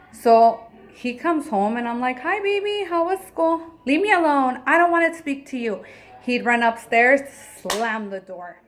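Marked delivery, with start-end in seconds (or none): 2.2-3.6 high, bright sing-song hello; sweet greeting. Retold or imitated. Mother greeting her son home from school, high and sweet. 3.8-5.8 put-on higher, louder, snapping child's voice, a bit fast; sulky child. Mother imitating her angry son slamming off upstairs, higher and louder.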